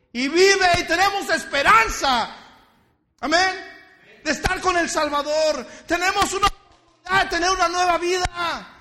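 high, loud shouted sermon; fiery preaching. Acted. Pastor shouting at the congregation, fiery preaching. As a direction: preach at full force.

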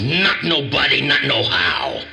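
blustering lion boast, slowing at the end; cowardly lion. Retold or imitated. Lion answering Dorothy, slow mock bluster. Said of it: "And he says".

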